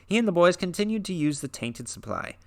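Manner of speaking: dramatic horror read-aloud, lightly intense; story narration. A narrator's reading. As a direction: read it like a spooky tale by the fire. Reads Lovecraft story aloud.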